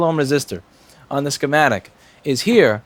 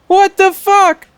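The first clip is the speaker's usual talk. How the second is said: stunned; 7 dB louder, much slower than usual. Real, not acted. Reading jumped unexpectedly.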